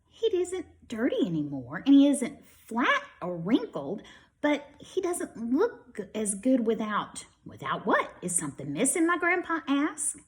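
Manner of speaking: lively storybook read-aloud; children's story voice for young listeners. A narrator's reading. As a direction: read it brightly to a class of kids. Reads picture book to children.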